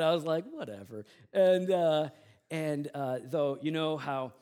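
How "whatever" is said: low, soft dismissal; unbothered shrug. Retold or imitated. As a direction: brush off the insult without anger. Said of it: "I didn't get mad about it".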